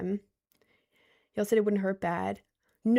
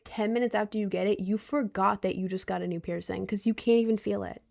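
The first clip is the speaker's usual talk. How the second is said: ordinary delivery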